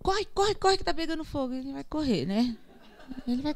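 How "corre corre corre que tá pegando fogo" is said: mock urgent call. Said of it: "Você fala".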